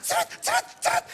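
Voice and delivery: very high "ceret" squeaks; imitated printer noise. Cash machine prints the receipt, high imitated chirps. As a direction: mimic a receipt printing in quick high bursts.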